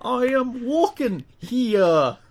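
high, slow fantasy take on New York; mystical D and D character. Acted. Podcaster merging New Yorker and mystical role-player, high and drawn out. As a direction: intone it grandly, very slowly. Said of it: "character he was playing".

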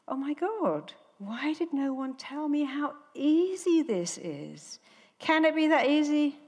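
high, wondering imitation; mock amazement. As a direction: play a flyer astonished at her own relief. Amazed passenger after painless take-off.

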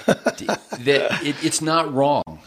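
chuckling through the words; laughing. Real, not acted. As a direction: laugh while you say it. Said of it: "you can hear the smile in my voice".

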